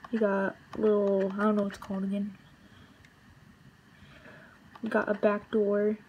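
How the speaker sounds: usual speaking voice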